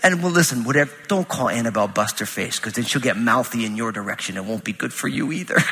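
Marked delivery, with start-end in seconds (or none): none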